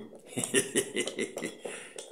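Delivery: breathy chuckle under his breath. Real, not acted. Laughs at own joke.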